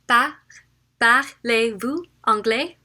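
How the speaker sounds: parlez-vous anglais slowed down, rising at the end; slow demo. Shown as a demonstration. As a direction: ask slowly and clearly. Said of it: "I should break it down".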